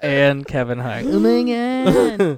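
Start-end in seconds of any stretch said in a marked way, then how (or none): 1.1-2.4 very slow, high, drawn-out put-on accent; mock Aziz voice. Host redoing the Aziz bit, very slow and high. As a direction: stretch it out like a whiny Iranian caricature. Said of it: "He does have that nasal".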